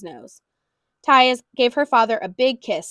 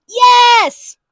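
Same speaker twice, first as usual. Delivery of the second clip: high, very loud shout; father shouting at the cashier; loud yell; 10 semitones higher, 10 dB louder than usual. A narrator's reading. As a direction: yell it back. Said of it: "The man yelled".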